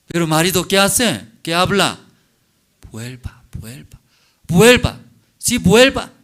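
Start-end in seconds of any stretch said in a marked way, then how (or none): 2.9-4.0 quiet, gentle calling back; soft plea. Acted. Husband softly asking his wife to return, quiet plea. 4.5-6.1 sudden loud, high cry of come back; loud plea. Acted. Husband crying out to his wife to return, loud plea.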